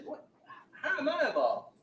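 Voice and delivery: high, loud startled question; shocked disbelief. Retold or imitated. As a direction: retell her shocked outburst. Accused woman reacts to police.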